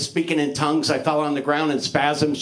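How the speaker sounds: ordinary talk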